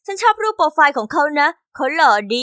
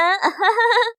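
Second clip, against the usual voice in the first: high sneering laugh at her; a short high jeering cackle; mocking laugh; 6 semitones higher than usual. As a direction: laugh down at the girl, scornful. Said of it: "ลิซ่าหัวเราะเยาะฉัน".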